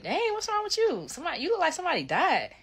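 high, quick surprised question; puzzled dang. Retold or imitated. Quoting herself to her mom, high and baffled. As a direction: ask it fast and high, baffled. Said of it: "I said this exactly".

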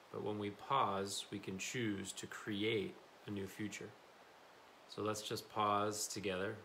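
calm, even meditation guide with long pauses; settled, unhurried instructions in short phrases; guided meditation. Real, not acted. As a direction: lead a body scan gently, leaving space.

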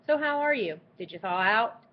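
usual speaking voice

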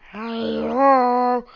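loud, drawn-out creepy call; scary hello. Acted. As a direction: stretch hello out loudly to frighten listeners. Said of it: "that was a little scary".

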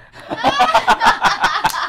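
loud, high burst of laughter; carcajada. Real, not acted. Se ríe de su propia anécdota. (carcajada fuerte y aguda)

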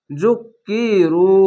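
জুকিরু slow, with the long u held; drawn-out recitation. Shown as a demonstration. Teacher reading the word to students, drawn out. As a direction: stretch the ending of জুকিরু. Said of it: "এক আলিফ টেনে পড়বেন".

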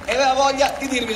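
big, high presenter's build-up; announcer voice. Acted. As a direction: announce him like a show host. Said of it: "con la voce adatta".

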